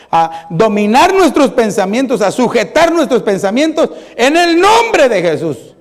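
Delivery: rousing exhortation. Acted. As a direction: drive it up to a ringing declaration. Exhorts the congregation, building.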